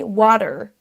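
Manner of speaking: water with a strong American final r; American water, heavy r; American rolled R. Shown as a demonstration. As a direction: curl the r at the end of American water. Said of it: "Le R est vraiment roulé".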